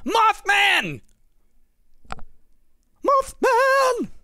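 very high squeal of a name; mock cry. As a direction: cry it out far above your own voice.

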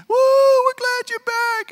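mock fans welcoming a star home; cheering crowd. Preacher mimics cheering hometown crowd, high excited whoop.

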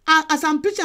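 ordinary talk

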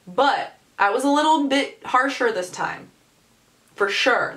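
ordinary talk, nothing marked

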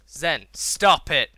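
loud, drawn-out telling-off; self-scolding. Real, not acted. Gamer telling himself to stop it, loud scolding. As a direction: scold yourself loudly and slowly.

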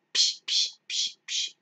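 sharp voiceless bursts of "psh"; imitated swatting-away noise; dismissive psh noises. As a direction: spit out quick whooshing shushes. Imitated noise acting out being done.

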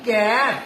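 ক্যাট blended into one long-vowelled word; pronounced as a word. Shown as a demonstration. Teacher contrasts saying with spelling, blended drawn-out cat. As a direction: sound out ক্যাট whole, stretching the "ae". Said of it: "যখন উচ্চারণ করছি".

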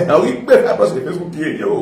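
usual speaking voice

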